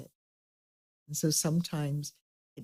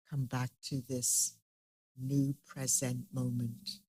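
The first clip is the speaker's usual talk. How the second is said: soft, unhurried meditation guide; guided meditation; 4 dB quieter than usual. Acted. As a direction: gently lead the group inward.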